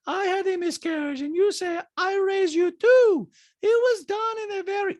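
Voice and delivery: high, loud put-on voice; Diana. Diana arguing over miscarriage talk, high mock impression. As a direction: mimic her arguing way up high. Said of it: "Diana's like".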